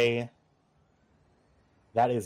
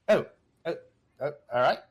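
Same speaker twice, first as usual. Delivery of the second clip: lightly voiced uppity British gentleman; a hint of uppity British accent; 4 semitones higher, 3 dB louder, faster than usual. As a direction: play a snooty British gentleman, briefly flustered. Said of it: "in a very uppity British accent".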